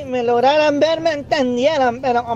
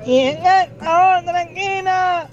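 lucha libre announcer; 7 semitones higher than usual. Retold or imitated. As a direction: boom it out like a ring announcer. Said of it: "un presentador de lucha libre".

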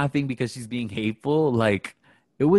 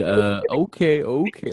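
drawn-out okay, then a higher one; lost, bewildered; 4 semitones higher, slower than usual. Retold or imitated. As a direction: mimic someone out of their depth. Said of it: "He was lost".

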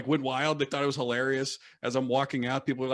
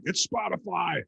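mock crowd yell; 7 semitones higher, slower than usual. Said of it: "just the screaming".

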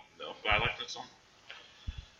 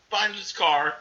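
ordinary talk

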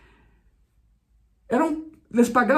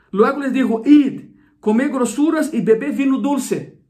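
scripture reading; 3 semitones higher, 3 dB louder than usual. Acted. Reads Nehemiah's command aloud.

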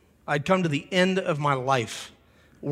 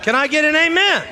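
high, loud call to the congregation; loud, ringing call-out; calling for an amen; 12 semitones higher, 8 dB louder than usual. Real, not acted. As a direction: rouse the crowd for an amen. Preacher calls out to congregation.

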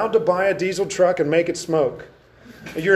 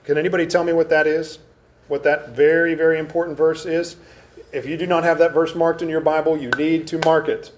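usual speaking voice

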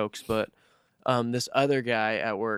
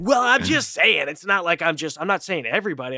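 higher, imitated self-justifying protest; mock defensive; 5 semitones higher, 5 dB louder than usual. Imitating a coworker excusing his remark, defensive. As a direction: start high and loud, protesting innocence.

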